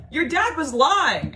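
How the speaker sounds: high, loud, drawn-out statement; emphatic revelation. Retold or imitated. Friend telling her dad lied, high emphatic statement. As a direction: imitate a friend stating it slowly and forcefully.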